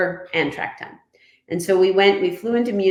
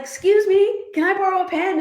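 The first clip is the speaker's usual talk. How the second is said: eager ask; 13 semitones higher, 3 dB louder, faster than usual. Retold or imitated. Urgent request for pen.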